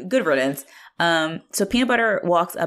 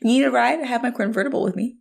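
higher, coy, breezy offer; flirty Nancy; 8 semitones higher than usual. Retold or imitated. Nancy offering the teacher a ride, flirty and higher. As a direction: tease him, half laughing. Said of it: "Nancy flirting with Dan".

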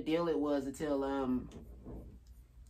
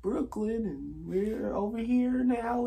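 slow, higher bored guide voice; tour guide voice; 5 semitones higher, slower than usual. Retold or imitated. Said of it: "he was just like".

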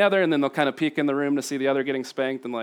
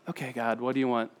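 calm prayer; 4 semitones lower, 8 dB quieter than usual. Shown as a demonstration. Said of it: "Taking a pause, breathing".

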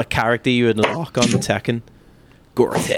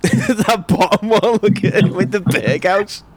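laughing; 8 semitones higher than usual. Real, not acted. Laughing at old photos.

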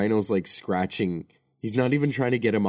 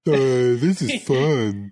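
very slow, dim-witted drawl; mock him as a slow simpleton; dopey voice; 3 semitones higher, 5 dB louder, much slower than usual. Co-host sneers at Higgins in a slow dopey voice.

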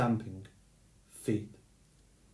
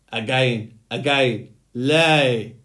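roaring louder with each word; a thundering giant demanding more; 9 dB louder, much slower than usual. Acted. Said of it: "With voice of thunder".

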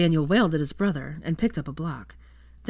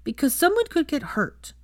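put-on high, pouty little-boy voice; pouting child; 10 semitones higher than usual. A narrator's reading. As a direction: answer grudgingly with a pout, high. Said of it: "replied with a pout".

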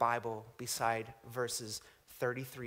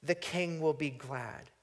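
slow, solemn reading of a psalm, lifting key phrases; scripture reading; 5 semitones higher, 6 dB louder than usual. Acted. Preacher reads the psalm aloud to his congregation, solemn and measured. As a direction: read the psalm like a proclamation.

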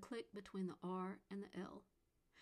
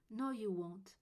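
put-on sulking child, louder; sulky boy; 5 dB louder, slower than usual. A narrator's reading. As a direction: snap it back like a hurt boy. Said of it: "Gregory had said".

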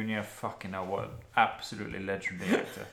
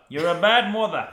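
louder, higher mock reprimand; mock scolding, louder and higher; 6 semitones higher, 10 dB louder than usual. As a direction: scold her in a hammed-up louder voice. Repeated louder as joke scolding.

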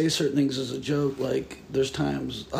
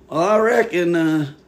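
put-on Southern accent; 3 semitones higher, 7 dB louder than usual. Podcaster chatting with friend, suddenly folksy Southern accent. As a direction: slip loudly into a folksy Southern twang. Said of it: "Why are you talking like that".

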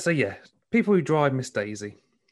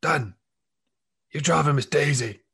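higher, louder Sheffield instructor; put-on Sheffield accent, raised voice; 5 semitones higher, 5 dB louder than usual. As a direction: bark it like a northern driving instructor. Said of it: "he was a northerner, he was from Sheffield".

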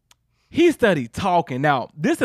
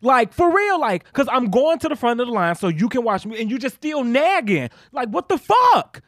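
getting mad; 7 semitones higher than usual. Real, not acted. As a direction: vent it hot and high. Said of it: "I'm getting mad".

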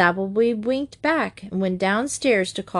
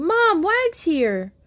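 high, drawn-out call to Mom; excited shout; 10 semitones higher, slower than usual. A narrator's reading. Betsy bursting in the door to tell Mom, excited shout. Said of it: "Betsy burst in the door shouting".

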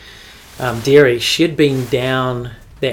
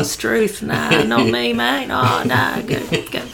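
higher-pitched, hammed-up Aussie accent; fake Australian accent; 11 semitones higher, slower than usual. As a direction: Kiwi pretending to be an Aussie bloke. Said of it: "you'd say you're Australian".

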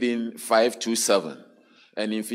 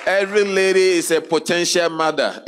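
loud, raised preacher's call; preaching at full force; 7 semitones higher, 6 dB louder than usual. Acted. Preaching opening to congregation.

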